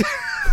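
high burst of laughter, cracking up; high cackle breaking out mid-story. Real, not acted. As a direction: cracks up laughing at his own punchline. Laughing at his own story.

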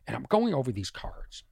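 ordinary delivery

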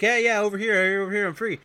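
high, eager mock call; calling for a pass. Retold man calls for the tossed member, eager shout. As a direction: act out a player calling out.

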